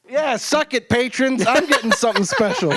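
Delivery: high-pitched teasing brag, breaking into laughter; giddy mock taunt; playful gloating. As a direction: rub it in jokingly. Gloating over patrons, laughing.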